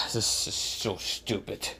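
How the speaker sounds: slow, soft, breath-heavy grumble; drained, whispery annoyance; exasperated muttering. Acted. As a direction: mutter it wearily on a sigh. Aku's exasperated complaint after a sigh.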